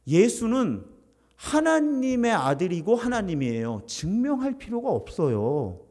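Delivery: usual speaking voice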